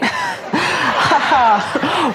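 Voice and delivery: bright burst of real laughter; laughing. Real, not acted. Cracking up at her own cheeky joke, real laugh.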